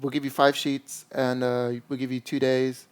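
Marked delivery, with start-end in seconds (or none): none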